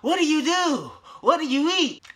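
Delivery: put-on high-pitched kid voice. Kid asking the ripped guy for advice in a high voice. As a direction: pitch it up like an eager young kid. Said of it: "He asked me".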